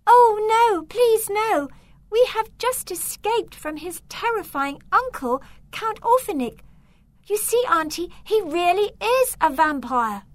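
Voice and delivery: put-on high, loud panicked girl's plea; frightened pleading. Beatrice begs her aunt not to take Victor home, high frightened plea. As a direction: beg her aunt not to send them back. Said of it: "Beatrice cried out".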